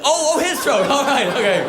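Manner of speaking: hammed-up sudden realisation; mock dawning realisation. Penny drops, mock realisation.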